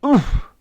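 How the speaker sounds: high grunt of impact; winded "oof". A narrator's reading. The Admiral is crushed by the mammoth, winded cry. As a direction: cry out as if squashed. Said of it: "cried the Admiral".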